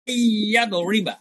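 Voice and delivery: ইয়া দরিবা with the doubled y held long; tashdid pronunciation. Shown as a demonstration. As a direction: hold the start of ইয়া দরিবা, slowly. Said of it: "আমি তাসদিদের উচ্চারণ করতেছি". (ইয়া দরিবা-তে তাসদিদ টেনে ধীরে উচ্চারণ)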